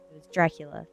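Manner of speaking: ordinary delivery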